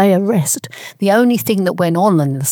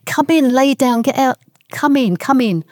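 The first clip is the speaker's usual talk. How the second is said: higher-pitched retold invitation to shelter; urgent calling in; 7 semitones higher than usual. Nanny beckoning a passer-by in from the bombing, retold urgent call. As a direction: imitate calling someone in, without shouting.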